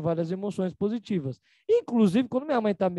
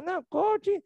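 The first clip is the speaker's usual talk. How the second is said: mocking critic; 10 semitones higher, slower than usual. Mocking people calling everything coach.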